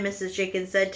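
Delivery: ordinary delivery, nothing marked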